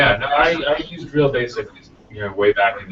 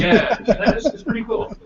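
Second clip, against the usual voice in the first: laughing; 13 semitones higher than usual. Real, not acted. Laughing at the Steve Jobs joke.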